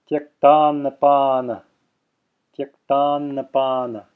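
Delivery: Tyaktannapana said slowly, the first n held; extended first n. Shown as a demonstration. Teacher giving another Sanskrit example, held first n in Tyaktannapana. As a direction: hold the double n in Tyaktannapana. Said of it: "extending that first n".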